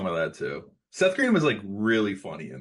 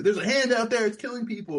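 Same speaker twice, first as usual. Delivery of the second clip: mock frantic warning; 13 semitones higher, 4 dB louder than usual. Max-style warning from stage.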